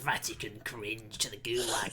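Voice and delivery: unvoiced whisper. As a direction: whisper it conspiratorially. Whispered joke aside.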